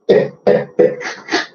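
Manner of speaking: short dry chuckle. Real, not acted. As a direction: let out a few soft, separate laughs. Rit après sa moquerie. (petit rire étouffé)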